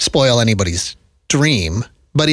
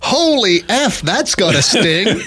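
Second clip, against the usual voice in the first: higher, slow, laughing call; hockey announcer swearing; 7 semitones higher, slower than usual. Pretend. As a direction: react to a brutal hit on air. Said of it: "not just like".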